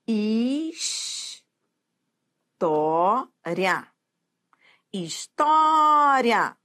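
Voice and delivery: História stretched syllable by syllable, slow; slow drawn-out História. Shown as a demonstration. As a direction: draw out História slowly, the first time rising, the second falling. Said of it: "teacher syllabifying slowly". (professora alonga História bem devagar)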